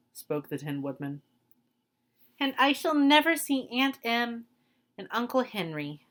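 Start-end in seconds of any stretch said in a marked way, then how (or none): 2.4-6.1 beginning to cry. A narrator's reading. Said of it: "beginning to cry".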